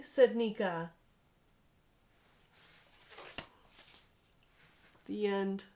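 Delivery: lively read-aloud storytelling voice; story narration. A narrator's reading. Parent reading a picture book to a child, animated narration.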